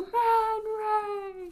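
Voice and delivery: high cartoon shout; cartoon yell. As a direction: yell it like Patrick. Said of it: "they both yell".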